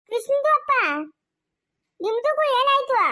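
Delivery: high, drawn-out neighbourly question; calling out. Acted. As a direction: call over and ask in a high-pitched voice. Neighbour calls out, asks what happened. (ಎತ್ತರದ ದನಿಯಲ್ಲಿ ಕೂಗಿ ವಿಚಾರಿಸುವುದು)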